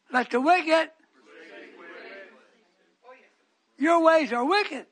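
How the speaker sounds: loud, high, slowing on the last; fervent preaching. Real, not acted. Preacher condemning wickedness, loud and fervent. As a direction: thunder the word wicked.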